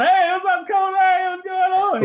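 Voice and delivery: high, loud slow hey called out; shouted greeting. Retold or imitated. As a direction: holler a greeting at the coach. Drug dealers greeting the coach.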